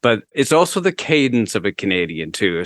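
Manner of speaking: ordinary talk, nothing marked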